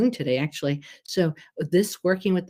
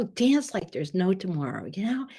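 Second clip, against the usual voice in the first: ordinary talk, nothing marked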